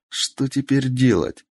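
ordinary delivery

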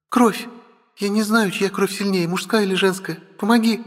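put-on high-pitched, urgent girlish voice; urgent dragon sister. Sister asks brother for blood, high and urgent. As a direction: plead for help as the little sister.

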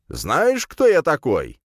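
high, forceful self-important demand; indignant challenge. A narrator's reading. Mage to insolent hero, indignant. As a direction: pull rank in a quarrel. Mage pulls rank in quarrel.

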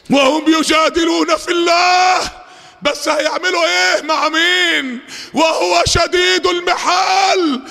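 high, slow, ringing proclamation; thundering warning. Real, not acted. Preacher warning those who dispute God, forceful high proclamation. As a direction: proclaim it with drawn-out vowels and rising force.

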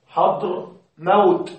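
حضر موت slowly, as two separate words; split into parts. Shown as a demonstration. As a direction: pause between حضر and موت. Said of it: "هل تشعر أنه مركب".

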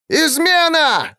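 very high, slow scream; yelled alarm. A narrator's reading. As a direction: cry out treason. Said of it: "заорал Оливер Голд".

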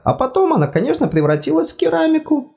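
high fussy god voice, apologetic; embarrassed god. Acted. God speaks.

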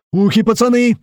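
high, very loud warning; full-throated yell. A narrator's reading. Musketeer warning before the blast, full-throated yell. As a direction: warn the boys at the top of your lungs. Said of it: "заорал во всё горло мушкетёр".

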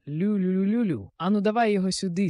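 high, loud hunting cry to a dog; hound-calling. A narrator's reading. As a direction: egg the hound on excitedly. King urging on his hound.

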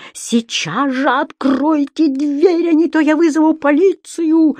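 high, loud, drawn-out threat; frantic bellowing. A narrator's reading. Landlady demanding the door be opened, frantic bellow. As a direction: yell it in a voice not your own. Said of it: "не своим голосом заорала хозяйка".

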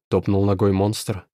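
plain reading voice

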